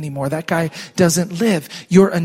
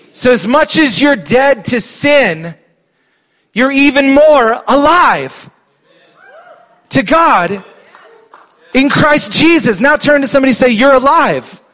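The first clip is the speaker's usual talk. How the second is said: louder, higher rising sermon; full-force preaching; 7 semitones higher, 6 dB louder than usual. Acted. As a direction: build it up for the congregation. Preaching build.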